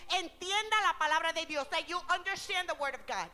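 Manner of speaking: loud, high preaching in Spanish and English; full-force preaching. Acted. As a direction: preach it out to the whole church. Preacher addressing congregation.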